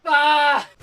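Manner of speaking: high, loud startled scream. Real, not acted. Gamer hit suddenly, loud startled scream. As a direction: scream as it hits.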